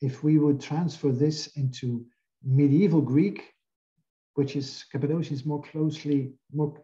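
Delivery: ordinary talk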